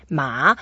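MA gliding upward; second tone. Shown as a demonstration. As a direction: start "Ma" low and let it climb. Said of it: "We go up a bit".

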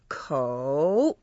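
kou stretched long, sinking then climbing; exaggerated third tone. Shown as a demonstration. Teacher letting learners hear the tone, exaggerated third tone. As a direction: draw kou down first and then up. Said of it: "So kou is the third tone".

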